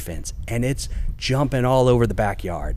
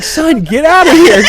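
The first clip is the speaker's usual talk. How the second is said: mock shout; 9 dB louder than usual. Shooing son away mid-struggle.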